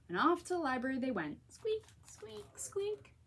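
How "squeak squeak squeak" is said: high soft squeaky wheel. A narrator's reading. Reading to children, high soft wheelbarrow squeaks.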